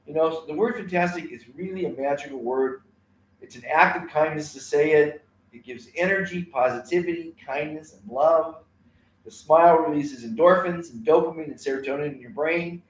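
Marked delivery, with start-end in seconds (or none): none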